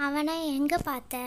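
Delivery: ordinary delivery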